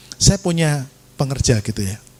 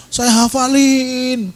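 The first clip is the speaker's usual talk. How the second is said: HAFALIN stretched long and high; drawn-out emphasis; 10 semitones higher, much slower than usual. Real, not acted. Preacher tells how he memorised it, hafalin stretched high. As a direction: draw out the last syllable of hafalin, high-pitched. Stresses his dogged memorising.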